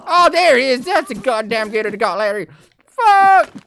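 high, loud panicked hillbilly villager; a frantic villager discovering his friend dead, shrieking. Retold or imitated. Player voicing a villager finding Larry dead, high panicked yelling.

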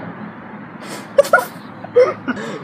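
high breathless squeal of laughter; shrieking laugh. Real, not acted. Laughing at a wrong guess.

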